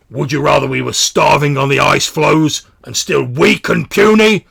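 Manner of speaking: high, very loud angry challenge; aggressive. Acted. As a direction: bellow it in the other one's face. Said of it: "he's getting quite aggressive".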